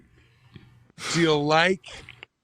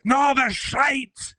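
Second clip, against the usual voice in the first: slow, high mock Scottish retort; Scottish accent; 13 semitones higher, much slower than usual. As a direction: drag out the Scottish put-down. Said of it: "someone will be like".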